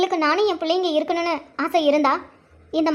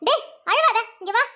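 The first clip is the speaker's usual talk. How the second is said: put-on higher, exasperated order to a crying child; impatient, raised-pitch command; scolding; 4 semitones higher than usual. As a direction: scold the baby to stop crying. Mother snaps at crying baby.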